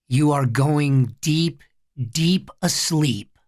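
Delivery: slow, even hypnotic induction; measured, drawn-out trance command; hypnotist. A narrator's reading. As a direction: lull him under word by word. Boy hypnotizes his little brother.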